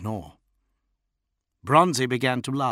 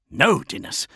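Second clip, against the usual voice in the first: snapping; 6 semitones higher, 4 dB louder than usual. A narrator's reading. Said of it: "Namet Jira snapped".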